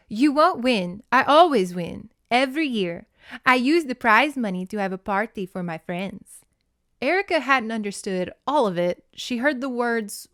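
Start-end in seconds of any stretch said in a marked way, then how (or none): 0.1-6.4 put-on mean girl. A narrator's reading. Rival girl boasting to Erica before race, smug. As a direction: read it like a cocky rival bragging. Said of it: "then she looked calmly at Erica and said".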